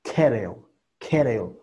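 "kettle" with the T tapped like an R; soft T as R. Shown as a demonstration. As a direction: say "kettle" as "keh-rel". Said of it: "podemos pronunciar".

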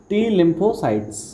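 टी lymphocytes drawn out very slowly, word by word; slow drilled term. Shown as a demonstration. Teacher drilling the class on a key term, very slow. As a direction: spell the term out slowly for students to memorise. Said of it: "याद रखेंगे हम।".

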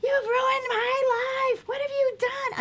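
shrill-pitched, loud, drawn-out wail; hysterical teen scream. Retold or imitated. Teenage daughter screaming at mom all the way home, hysterical. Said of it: "I remember screaming at her".

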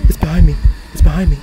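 loud panicked warning; alarmed, ghost right behind; being chased. Real, not acted. Ghost behind during hunt.

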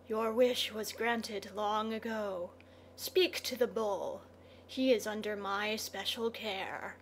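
soft scorpion voice, coolly dismissive; hushed, understated menace; sinister scorpion, unhurried. A narrator's reading. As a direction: answer quietly as a creature in darkness.

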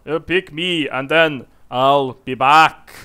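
a hammed-up Arnold accent, drawn out. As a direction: do a clumsy Arnold accent as the half-orc. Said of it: "mixing two accents".

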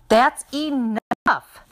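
high, loud, drawn-out exasperation; fed up. A narrator's reading. Mom stops her kids banging the table, fed up. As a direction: stretch it out to halt the squabble.

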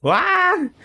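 a sudden high squeal of delight; excited squeal. Real, not acted. Gamer sees a gold pull, high excited squeal.

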